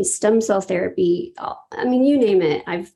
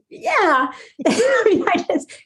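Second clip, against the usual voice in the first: laughing; 12 semitones higher, slower than usual. Real, not acted. Embarrassed reply to praise.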